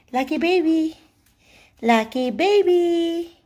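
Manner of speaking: high, sing-song cooing; high doting pet-owner voice. Real, not acted. Cooing to her dog.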